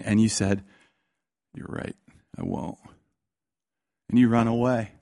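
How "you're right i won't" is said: very soft and low, barely there; small, resigned near-whisper; defeated whisper-quiet reply. Retold or imitated. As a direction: give in quietly, beaten down. Child giving up after scolding.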